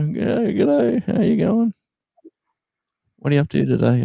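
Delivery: very high, singsong Aussie greeting; friendly locals. Retold or imitated. Locals greeting a regular in the shop, high singsong. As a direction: chirp g'day like a cheerful local. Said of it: "those same people go".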